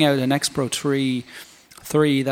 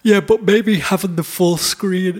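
talking through a yawn; slow words stretched by a yawn; 5 semitones higher, slower than usual. Real, not acted. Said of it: "Excuse me, I'm yawning".

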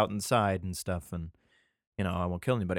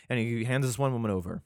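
normal talking voice

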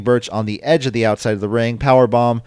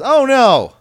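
a shrieked, much higher "oh no!"; mock alarm; 8 dB louder than usual. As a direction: yelp it out high and loud like a sudden fright. Sudden mock dismay at attack.

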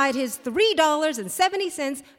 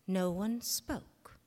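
usual speaking voice

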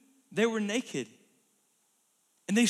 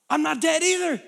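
louder, higher relieved surprise; surprised Adam; 6 semitones higher, 8 dB louder than usual. Acted. Adam, surprised he has not died, louder. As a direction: realise you're still alive. Adam voiced after eating.

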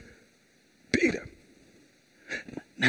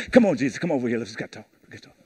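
low, hushed, chummy Come on, Jesus; patronizing Peter; 10 semitones lower, 5 dB quieter, much faster than usual. Retold or imitated. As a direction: pull him aside like a condescending friend. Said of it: "puts his arm around Jesus".

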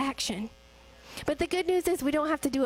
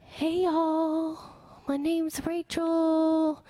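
slow, hesitant first words on stage; shy girl forcing herself to speak; timid speaker greeting the church from the stage; nervous greeting; much slower than usual. Retold or imitated. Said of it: "I got up here and I was like".

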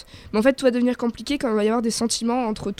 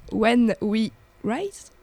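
careful, slow English accent on the title; much slower than usual. Shown as a demonstration. Reading an English show title to friends in a slow English accent. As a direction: say When We Rise slowly in a self-conscious English accent. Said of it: "avec mon superbe accent anglais".

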